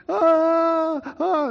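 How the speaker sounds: high, loud pained wails; crying out loud. Retold or imitated. Boy wails at thawing hands, high and loud. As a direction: wail like thawing hands hurt. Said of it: "I'm crying out loud".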